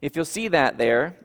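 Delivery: ordinary talk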